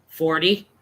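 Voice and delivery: low, subdued answer; reluctant admission. Retold or imitated. Adult admitting age to a kid, reluctant. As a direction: admit it low, with a slight questioning lift. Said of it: "I said, um".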